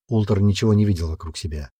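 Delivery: ordinary delivery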